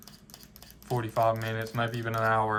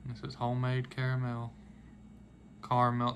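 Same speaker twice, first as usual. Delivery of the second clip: ordinary talk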